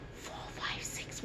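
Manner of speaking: soft unvoiced whisper right in the ear. Shown as a demonstration. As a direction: whisper the numbers so only one ear hears. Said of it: "I'm going to whisper in your right ear".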